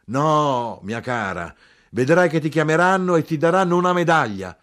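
high, loud, fervent proclamation; full-voiced, high-pitched promise. A narrator's reading. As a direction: swell into an exalted shout. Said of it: "Più forte gridai".

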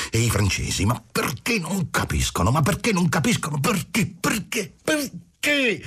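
climbing from agitation to a high, near-screamed "perché". A narrator's reading. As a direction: let the pitch climb step by step until almost shrieking. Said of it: "con voce gradatamente più alta".

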